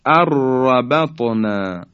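slow "arrabatna" for اربطنا, r held and trilled; doubled ra. Shown as a demonstration. Teacher repeats the merged word, nun dropped entirely. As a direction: roll a long r in اربطنا with no n.

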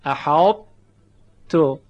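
احاط تو with a thick, heavy ط before the ت; exaggerated partial merge, heavy ط; heavy ta kept. Shown as a demonstration. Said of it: "درشتی صدای تا باقی میمونه".

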